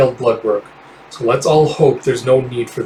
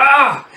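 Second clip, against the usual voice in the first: much higher short ah; high pained cry; 12 semitones higher than usual. Acted. A fighter hurt in the melee, high cry. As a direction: cry out in pain. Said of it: "It's like".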